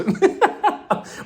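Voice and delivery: high, breathy cackle; laughing. Real, not acted. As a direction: crack up at your own comeback. Laughs at her comeback.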